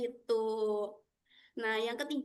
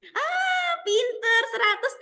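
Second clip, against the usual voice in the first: much higher, louder excited praise; delighted praise; 10 semitones higher, 4 dB louder than usual. Real, not acted. Praising a child's answer. (memuji dengan sangat gembira)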